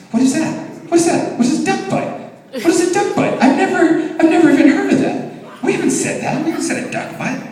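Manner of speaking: very high, mock mumbling little-sister voice; muttering baffled sister. Sister asking herself questions in church, high baffled muttering. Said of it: "My sister's mumbling to herself, asking questions".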